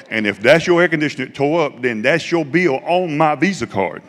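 preaching climax. Retold or imitated. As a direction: build it to a full preacher's cry. Realization climax preached at congregation.

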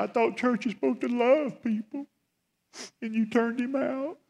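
a distressed young girl confronting her pastor, imitated high; high teenage girl voice.

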